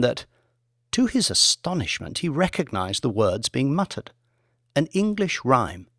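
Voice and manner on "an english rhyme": usual speaking voice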